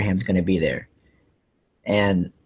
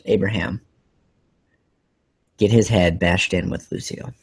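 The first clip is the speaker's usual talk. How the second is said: ordinary talk, nothing marked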